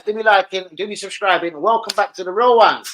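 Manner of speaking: ordinary delivery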